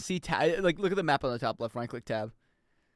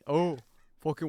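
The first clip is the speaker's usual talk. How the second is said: usual speaking voice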